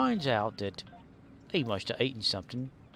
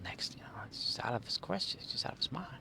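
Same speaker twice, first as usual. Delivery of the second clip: soft unvoiced whisper; 8 dB quieter, faster than usual. Real, not acted. Streamer musing about Walken, whispering. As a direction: breathe it out like a secret.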